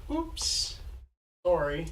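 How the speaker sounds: high, innocent oops; playing dumb. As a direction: feign clueless innocence. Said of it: "I'm just gonna play stupid".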